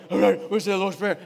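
loud, gruff huddle shout; hammed-up manly team bellow. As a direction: imitate wrestlers rallying before a prayer. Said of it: "in the deepest, manliest voice we got".

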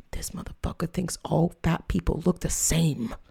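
hushed, breathy whisper; whispered aside. Retold or imitated. Thinking privately about Jerome, whispered. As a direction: whisper it like a secret thought.

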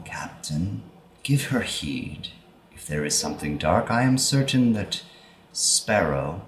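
put-on thin, reedy old woman's whisper. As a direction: speak slow and papery, like an ancient oracle. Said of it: "in this kind of old, kind of reedy voice".

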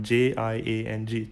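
ordinary delivery

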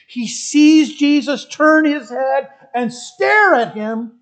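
high-pitched dramatic retelling, swelling loud at the end; impassioned preacher painting a picture; intense preaching. Acted. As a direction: build the scene to a loud climax. Preaches the scene again intensely.

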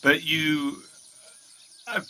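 ordinary delivery

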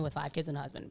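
normal talking voice

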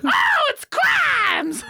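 loud falsetto shriek, very drawn out; high-pitched frenzy. Retold or imitated. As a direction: wail it sky-high to rile the dog. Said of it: "the higher pitch my voice gets".

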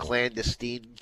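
"clandestine" ending in a long "steen"; mispronounced clandestine. Real, not acted. Co-host slips on a word, mispronounced clandestine. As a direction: say "clandestine" as clan-duh-STEEN. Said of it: "Then you said".

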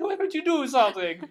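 high, loud plea for help; hammed-up desperate cabbie; mock begging. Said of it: "begging for him to stop".

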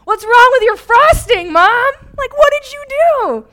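high, loud outraged yell; outraged shout. Retold or imitated. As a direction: yell at mom in disgust. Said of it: "And I was like".

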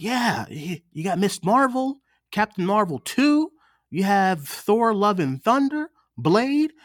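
high, eager rattling off of titles; excited listing. Retold or imitated. Telling his boss the new slate, eager and high. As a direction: hype up the new movies to him.

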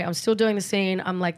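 ordinary talk

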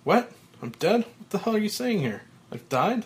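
shocked, high-pitched outburst; can't believe he's dead; disbelief. Acted. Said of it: "mouth open in disbelief".

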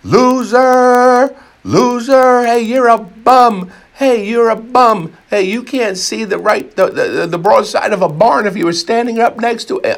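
high, loud stadium heckling; hollering insults from the stands; jeering fans yell. Retold or imitated. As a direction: bellow it like a jeering crowd. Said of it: "they're yelling".

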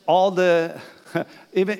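ordinary delivery

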